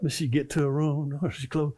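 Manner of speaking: usual speaking voice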